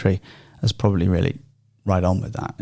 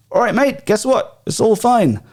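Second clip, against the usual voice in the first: put-on jokey Gloucester accent, pitched much higher; cheery West Country Gloucester bloke breaking the news; 11 semitones higher than usual. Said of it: "a Gloucester accent came out".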